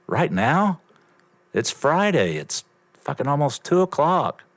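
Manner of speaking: high, disbelieving objection; incredulous protest. Retold or imitated. Grandson told to come to work right now, incredulous. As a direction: raise the pitch sharply in disbelief. Objecting to being called in Friday.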